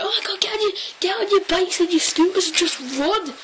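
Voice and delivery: high, urgent call to flee; urgent panic. Retold or imitated. Kid telling her friends to run, high and urgent. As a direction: rush them off, half panicked.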